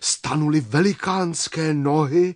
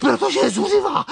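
high, loud, breathless exclamation; panicked cry; 11 semitones higher, 5 dB louder than usual. A narrator's reading. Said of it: "vykřikl kvapník".

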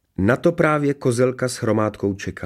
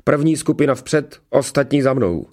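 raised, higher-pitched military order; commanding; 6 semitones higher, 4 dB louder than usual. A narrator's reading. Commander orders guards onward, commanding. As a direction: send the men off briskly. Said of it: "přikázal".

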